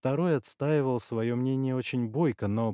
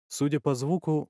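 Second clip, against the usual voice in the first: usual speaking voice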